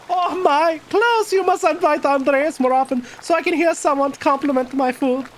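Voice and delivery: high-pitched hostess voice; Marie. Acted. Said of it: "Ooh, Marie".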